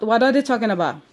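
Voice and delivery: normal talking voice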